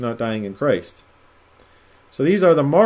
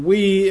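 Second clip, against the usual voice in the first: "we" lifted higher and held; stressed word; 6 semitones higher, 3 dB louder than usual. Shown as a demonstration. Bible teacher shows his class the stressed "we". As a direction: hit "we" hard. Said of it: "is emphasized".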